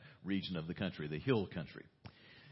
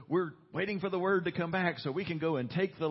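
eager crowd; 10 semitones higher, 6 dB louder than usual. Retold or imitated. Eager crowd before the bad report.

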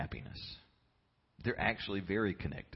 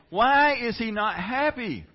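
loud, high, drawn-out cry of disbelief; exasperated outburst; 13 semitones higher, 13 dB louder, slower than usual. Acted. Preacher mock-baffled at the unhappy fish, exasperated outburst. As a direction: wail the question at full volume.

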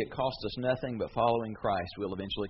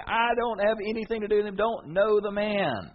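angry denial; 10 semitones higher, 7 dB louder than usual. Pretend. Peter denying Jesus a third time, angry. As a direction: spit it out and mean it.

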